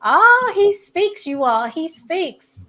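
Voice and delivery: high, loud, slow drawn-out oh; playful mock amazement; excited teasing. Real, not acted. Teasing the quiet guest.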